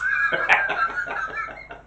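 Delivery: very high burst of laughter. Real, not acted. Comedian cracking up at his punchline, a very high laugh. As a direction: break up laughing at the joke.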